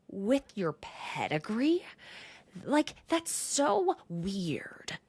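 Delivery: slow, drawn-out mock indignation; offended retort. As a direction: act affronted, stretching the words. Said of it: "flicks her nails at Taylor and says".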